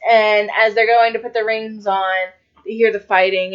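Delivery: ordinary delivery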